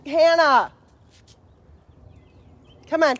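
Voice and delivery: sharp high call across the yard; calling the dog. Real, not acted. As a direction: shout the dog's name to stop her barking.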